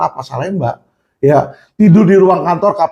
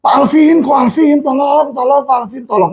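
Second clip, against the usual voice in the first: imitating a panicked inmate crying out for help; desperate prisoner begging; 7 semitones higher than usual. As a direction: beg for help as if your life depends on it. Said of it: "kenalan-kenalan saya di rutan, di lapas itu nelfon saya".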